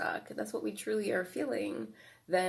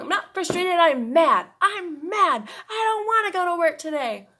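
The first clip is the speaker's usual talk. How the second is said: put-on high, loud, angry little-kid voice; inner child voice; 10 semitones higher, 9 dB louder than usual. Shown as a demonstration. Inner child correcting the adult, high, loud and mad. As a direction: stamp it out like a mad five-year-old. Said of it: "We can role play that out".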